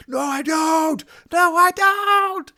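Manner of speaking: high, loud shout, dragged out slowly; high drawn-out shouted denial; shouted refusal. Retold or imitated. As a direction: scream the refusal, stretching every word. Freaky Nikki rejecting him.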